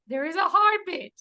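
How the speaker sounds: repeating the happy news to worried onlookers, beaming; overjoyed. Retold or imitated. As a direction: let the happy news spill out.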